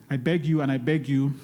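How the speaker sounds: ordinary talk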